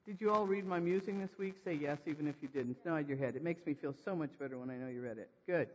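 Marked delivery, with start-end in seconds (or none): none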